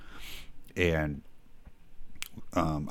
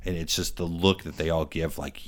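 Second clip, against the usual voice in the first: ordinary talk, nothing marked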